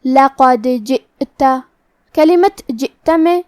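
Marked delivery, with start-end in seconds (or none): 0.0-1.4 لقد with the د of لقد bounced as an echoing stop, slow; slow Quranic recitation, bouncing the د in لقد; tajweed qalqala demo; qalqala on dal. Shown as a demonstration. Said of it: "ہم حرف الدال کو ہلاتے ہوئے یہ کلمہ کو پڑھیں گے".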